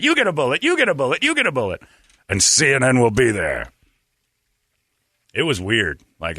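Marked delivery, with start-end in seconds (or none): 0.0-1.8 high, loud giveaway cry; mock Oprah giveaway. Imitating Oprah giving gifts to the audience, high and loud. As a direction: hand them out like Oprah on her show. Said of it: "Oprah's throwing 'em out".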